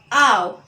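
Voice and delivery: ow, said as in cow; ow sound. Shown as a demonstration. Teacher sounding out ow for the class. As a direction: say ow like the vowel in cow. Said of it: "So O W makes".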